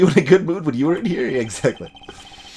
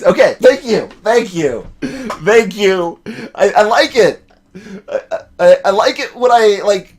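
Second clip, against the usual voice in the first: laughing thanks; 4 semitones higher, 6 dB louder than usual. Real, not acted. Players laughing at game joke.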